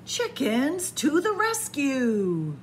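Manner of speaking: usual speaking voice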